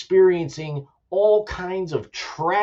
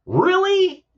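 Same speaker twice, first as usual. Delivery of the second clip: a sharp, much higher "Really?" of disbelief; stunned, mocking disbelief; incredulous; 3 dB louder than usual. Real, not acted. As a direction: throw it back in total disbelief. Incredulous at a political claim.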